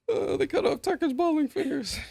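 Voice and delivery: very high, squeaky with laughter; laughing mock dismay; dying laughing. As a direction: retell it while cracking up. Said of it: "had me dying laughing".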